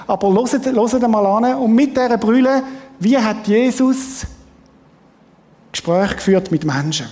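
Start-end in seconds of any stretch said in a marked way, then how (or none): none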